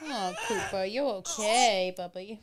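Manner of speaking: real soft-voiced concern; tender aside. Real, not acted. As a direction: quietly fuss over a little one, unhurried. Checking on Cooper, aside.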